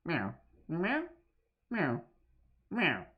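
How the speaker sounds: playful cat meows. Pretend. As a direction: meow like a cat.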